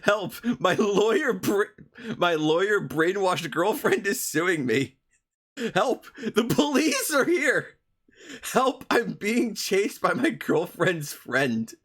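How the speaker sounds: high, drawn-out hammed-up plea; slow, overdone distress of a hapless poster; mock cry for help. Imitating how this guy thinks, hammed-up distress. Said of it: "it'll be more like this".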